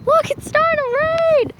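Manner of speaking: high, loud excited call; excited exclaim. Real, not acted. Showing viewers the rain beginning, excited and loud. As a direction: point it out to the viewers, thrilled. Rain starts while filming outside.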